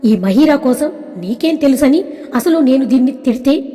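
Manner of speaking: plain reading voice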